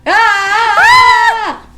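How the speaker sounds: loud, high excited squeal; excited scream. Real, not acted. Podcaster excited for episode ten, loud high scream. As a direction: let out a piercing excited scream. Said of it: "we're loud today".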